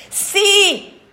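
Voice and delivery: sudden loud high cry of Sì!; shout. A narrator's reading. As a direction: blurt it out at full voice.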